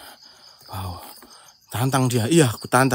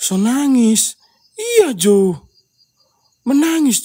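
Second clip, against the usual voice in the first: high loud call; 7 semitones higher, 6 dB louder, slower than usual. Real, not acted. Calling to an unseen crying child.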